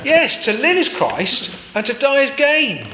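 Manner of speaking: high, slow, emphatic declaration; emphatic conviction. Acted. Paul answers the jailer's challenge.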